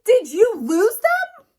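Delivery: very high, loud shocked question; shocked accusation. Acted. Sheep asks Sheepdog where the sheep went, shocked loud cry. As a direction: demand it in alarm.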